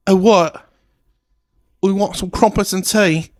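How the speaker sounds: high, loud mock British voice; British accent. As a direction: camp it up as a mock British toff. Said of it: "They talk like that".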